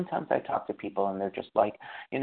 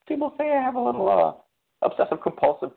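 much higher, fast, self-mocking squeak; squeaky admission; 5 dB louder than usual. Real, not acted. Admitting to the group that people call him obsessive, high and fast. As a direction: squeak it out, embarrassed.

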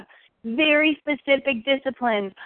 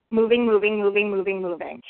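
each moving softer and lower, dying away; fading repetition; 4 semitones lower, 4 dB quieter than usual. Shown as a demonstration. Speaker illustrating a swan's paddling legs to the group, fading chant. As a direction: let the chant of moving fade to nothing.

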